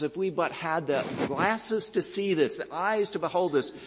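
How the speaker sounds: preacher swept up in Calvin's vision of angels; awed wonder. Retold or imitated. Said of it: "and Calvin says".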